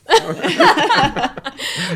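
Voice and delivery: loud, high laugh; real laugh. Real, not acted. Guest laughs at his own book title idea, loud real laugh. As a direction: laugh out loud, falling away.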